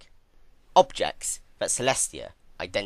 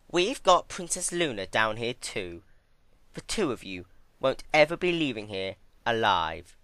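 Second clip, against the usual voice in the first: higher, louder, menacing villainess; gloating threat; 4 semitones higher, 6 dB louder than usual. Acted. Twilight threatening Celestia with death, higher gloating. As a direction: savour telling her she'll never leave.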